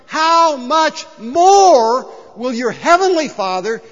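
loud, high, drawn-out preaching; emphatic proclamation. Acted. Preacher urges congregation to get their head around it, emphatic. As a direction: proclaim it at full force, stretching the words.

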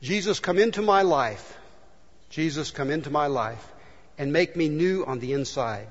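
soft, hushed prayer voice; leading a quiet prayer of forgiveness. Acted. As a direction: pray it gently, leading them. Leads the congregation in prayer.

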